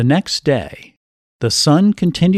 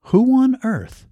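sudden high surprised question; high, startled who on earth; 9 semitones higher than usual. A narrator's reading. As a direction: jump at the knock. Startled by a knock.

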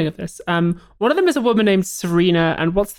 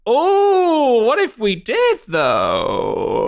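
sly villain musing; 4 semitones higher, much slower than usual. Retold or imitated. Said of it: "they're like".

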